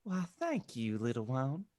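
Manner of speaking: Southern preacher's gentle twang. Acted. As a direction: play a welcoming Southern reverend. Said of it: "had a distinctive Southern twang".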